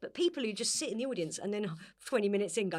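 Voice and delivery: ordinary talk, nothing marked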